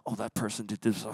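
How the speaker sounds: low, very soft grumble; quiet, low replay of a grievance; brooding inner voice. Acted. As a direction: grumble to yourself over an old hurt. Voicing brooding thoughts all day.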